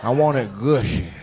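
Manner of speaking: ordinary talk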